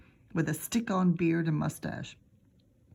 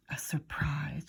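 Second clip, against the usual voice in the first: soft, breathless wonder; hushed at the idea of a surprise; awed whisper; 3 semitones lower, 6 dB quieter, slower than usual. A narrator's reading.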